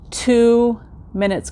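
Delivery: "two minutes" stretched out slowly; slow emphasis. Shown as a demonstration. Telling viewers how short the treadmill test was, slow stressed "two minutes".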